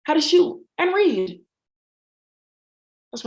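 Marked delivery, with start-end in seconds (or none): 0.0-1.4 higher mock-sweet intro voice; imitating cousin. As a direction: play the cousin introducing herself. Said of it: "my cousin Jamaica Somerville exposing it".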